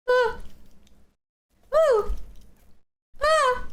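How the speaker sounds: short high-pitched "ooh" and "ah"; little high yelps, tried out one by one; testing jump sounds. Shown as a demonstration. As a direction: test out your own hop noise. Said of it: "What kind of sound I make".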